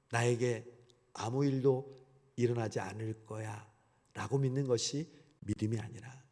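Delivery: ordinary talk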